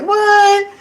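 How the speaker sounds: very high, loud startled what; shocked what. Retold or imitated. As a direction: react like you can't believe it. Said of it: "I was like".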